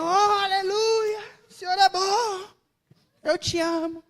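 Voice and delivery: high, loud, slow and crying with joy; retelling a tearful moment of worship, crying praise. Retold or imitated. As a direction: sob out your love for God. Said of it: "comecei a chorar".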